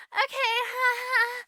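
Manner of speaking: high put-on fake laugh; mock an eager little giggle; giggly stepsisters. Stepsisters accept the prince's shoe, giggly and high.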